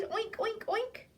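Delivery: high, squeaky oinking; the dog oinks like a pig. Pretend. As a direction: silly pretend pig. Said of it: "who says".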